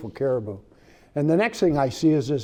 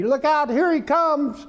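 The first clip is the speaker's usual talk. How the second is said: much higher, slow warning call; retold yell; 12 semitones higher, 3 dB louder, slower than usual. Young hunter calling a warning about the charging bear, high yell. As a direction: shout a warning across the ridge. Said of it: "And he yells at me".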